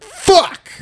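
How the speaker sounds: sudden very high yelp of dismay; mock outburst. Imitating a buddy who killed the wrong fish, high yelp. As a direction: yelp it out like you just ruined your catch. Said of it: "he kills it and he's like".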